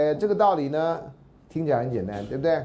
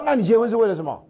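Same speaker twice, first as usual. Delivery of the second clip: higher and louder, indignant comeback; defiant; 7 semitones higher, 6 dB louder, faster than usual. Retold or imitated. Resentful parent challenging the speaker about his marriage. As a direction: fire the question back, unconvinced. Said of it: "他就很不服气啊".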